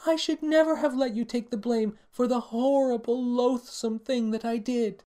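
very high, hammed-up tearful confession; bawling princess. A narrator's reading. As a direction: bawl with exaggerated remorse. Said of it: "bawled Primaria".

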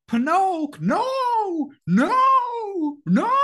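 high squeaky cricket wailing no, far above normal voice; Jiminy Cricket crying no. Retold or imitated. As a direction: wail it like a tiny desperate cricket. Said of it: "He does, like, the".

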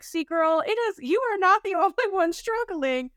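high, laughing pep talk to herself; playful self pep talk. Retold or imitated. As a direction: cheer yourself on, pitch way up with a laugh. Cheering herself on on the rocks.

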